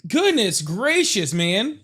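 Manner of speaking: high, slow drawn-out exclamation; stunned. Real, not acted. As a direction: stretch it out in disbelief. Still reeling from the scream.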